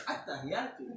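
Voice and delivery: At-tahiyatu in a soft, slightly foreign Arabic lilt; mild accented At-tahiyatu. Shown as a demonstration. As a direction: say At-tahiyatu with a light non-native accent.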